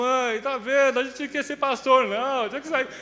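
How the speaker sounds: young son pleading with his mother, in mock tears; retold crying plea. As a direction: beg your mother through mock tears to quit. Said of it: "Mas eu chorei de novo".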